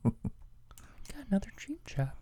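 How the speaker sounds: unvoiced conspiratorial whisper. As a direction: breathe it like a secret. Secret aside whisper.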